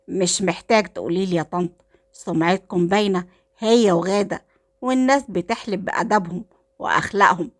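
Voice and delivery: ordinary delivery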